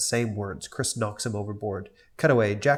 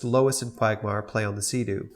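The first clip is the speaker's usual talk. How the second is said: usual speaking voice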